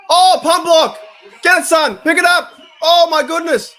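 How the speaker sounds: very high, loud yells at the play; real high-pitched fan yelling; excited shouting. Real, not acted. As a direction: shout your team on, voice way up. Shouting at a blocked punt.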